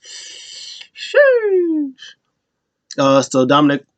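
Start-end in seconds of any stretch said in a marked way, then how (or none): 0.0-1.9 much higher, squeaky put-on sheesh; a cartoonish impressed exclamation; hammed-up reaction voice; mock sheesh. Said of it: "Dominic Mysterio's like".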